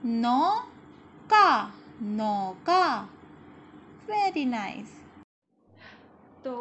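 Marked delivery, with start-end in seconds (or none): 0.1-3.1 मोर loud, the second slowly drawn out; read-along word. Shown as a demonstration. Teacher reading words aloud for children to repeat, slow and loud. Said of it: "last शब्द है हमारा".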